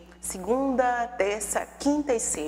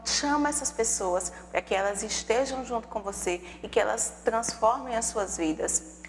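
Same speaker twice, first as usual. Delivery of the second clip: ordinary talk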